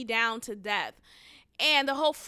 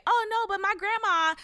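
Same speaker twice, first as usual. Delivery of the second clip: mock whiny excuse; 8 semitones higher than usual. Mocking the stylist's excuse.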